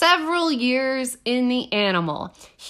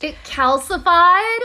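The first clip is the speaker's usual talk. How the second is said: high, loud rising question; appalled, pitch leaping upward; shocked disbelief; 7 semitones higher, 6 dB louder than usual. Real, not acted. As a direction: blurt it out in horrified disbelief. Reacts in horror to dead parasite.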